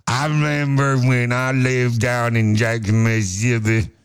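slow, drawn-out Southern movie drawl; Sling Blade character. Acted. Host imitates a movie character for co-host, slow Southern drawl. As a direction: impersonate a slow-talking Southern film character. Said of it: "It's from an old one with Billy Bob Thornton called Sling Blade".

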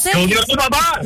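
say it loud. Shown as a demonstration. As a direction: belt it out this time. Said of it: "dígalo duro".

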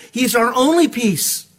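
ordinary talk, nothing marked

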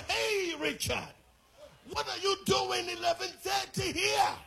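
loud, high scolding call; father shouting at sleeping son; shocked shout. Retold or imitated. Father finds son asleep midday.

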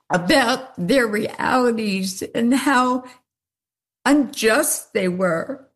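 moved to tears while speaking; real tearful voice. Real, not acted. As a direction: fight back tears between phrases, breaking into a tearful laugh. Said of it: "It brought her to tears".

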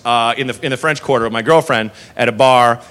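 ordinary talk

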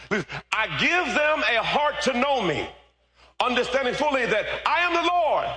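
high, loud, stretched scripture cry; full-force preaching. Real, not acted. Preacher declaring God's word to the church, high full-force preaching. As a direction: proclaim the verse high and slow.

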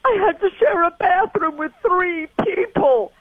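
slow, high mock American teenager; drawn-out, high-pitched American teen impression; American accent. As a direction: imitate your son's American accent, dragging out the dreadful complaint. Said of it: "in his American accent".

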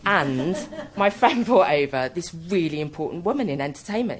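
usual speaking voice

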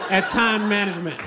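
ordinary talk, nothing marked

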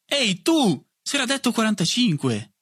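high, indignant yell; clamouring after someone. A narrator's reading. Joseph yelling after a short-paying pupil, high protest. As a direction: call angrily after someone running off. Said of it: "gli strepitò dietro Joseph".

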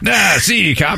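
lightly voiced 1930s crook; a hint of a movie mobster; old-timey gangster. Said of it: "like an old-timey, like, robber".

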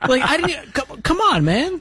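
high-pitched, laughing mock indignation; jokingly affronted, voice shooting up; mock protest. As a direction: protest in a high, playful squeal. Mock protest at being outdone.

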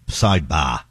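put-on "Sidebar" in a Boston accent, last syllable "bah" with no r. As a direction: drop the r in "Sidebar" Boston style. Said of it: "Not, not the Boston pronunciation of bar".

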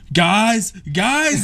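high, urgent call for attention; retold yelling. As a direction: call out excitedly to the family, re-enacted. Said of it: "I was yelling at".

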